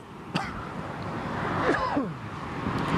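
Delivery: sudden dry cough; coughing fit. Real, not acted. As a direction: cough breaks the sentence. Cough mid-sentence on air.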